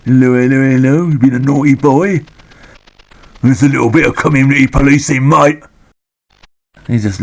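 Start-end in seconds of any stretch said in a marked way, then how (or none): 0.1-5.6 higher, louder cocky London copper taunting; mock bully copper. Reviewer voicing a copper picking on Andy, hammed-up and cocky. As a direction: lean in like a smug bobby threatening a suspect.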